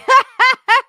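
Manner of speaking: loud high laugh. Real, not acted. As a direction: crack up at the joke. Laughs at Alexa ignoring.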